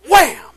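loud, high "wham"; explosive imitated punch; punch sound effect. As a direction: shout it like a blow landing.

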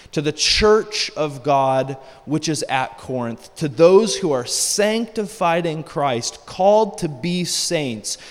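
slow, weighty, emphatic read-aloud; scripture reading. Acted. Preacher reading Paul's greeting to the church, slow and emphatic. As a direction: proclaim the verse deliberately, phrase by phrase.

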